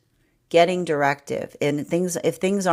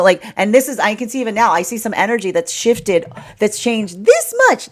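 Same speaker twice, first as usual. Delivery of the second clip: normal talking voice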